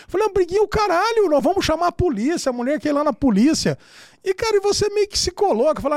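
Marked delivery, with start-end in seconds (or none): 0.3-3.8 high, indignant retort; retold outrage, pitched sharply up; desperate wife. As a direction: act out a wife refusing to back down. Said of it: "a mulher lá tá desesperada".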